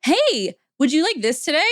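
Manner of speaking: high, bright recorded greeting; cheery recording. Retold or imitated. Drive-thru recording greets the customer, mock-cheery and high. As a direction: sound like an upbeat pre-recording. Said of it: "they're like".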